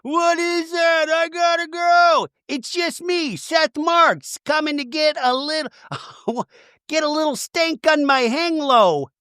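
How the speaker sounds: high, frantic, shrieking mock panic; mock frantic Meredith. Meredith spotting something in the woods, hammed-up panic. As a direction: shriek it out like you're terrified in the woods. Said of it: "And Meredith goes".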